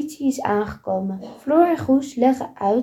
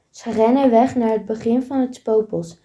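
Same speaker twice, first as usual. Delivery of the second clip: ordinary delivery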